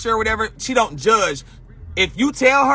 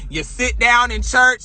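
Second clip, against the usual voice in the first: ordinary talk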